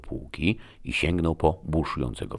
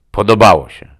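loud, angry snap; snarling; 6 semitones higher, 16 dB louder than usual. A narrator's reading. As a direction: throw the word back angrily. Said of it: "warknął". (głośne, gniewne warknięcie)

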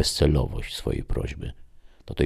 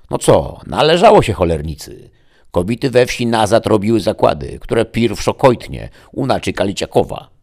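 loud, high spiteful village gossip; 7 semitones higher, 9 dB louder than usual. A narrator's reading. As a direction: sneer it out, much higher and louder. Gossiping wife mocks the dead. (złośliwa, głośna plotkara)

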